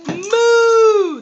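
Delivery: loud, high, drawn-out moo like a cow. Shown as a demonstration. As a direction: belt out a big cow moo for the kids. Teacher imitates cow for class.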